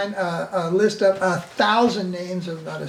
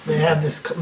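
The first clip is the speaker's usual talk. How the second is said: ordinary talk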